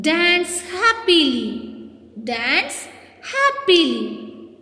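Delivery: slow, drawn-out command with a falling end; stretched, sing-out teacher's prompt; called-out action command. Shown as a demonstration. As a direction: call it out slowly for a class to act out.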